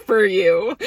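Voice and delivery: giggling through the words; laughing. Real, not acted. Vlogger teasing Miriam, laughing.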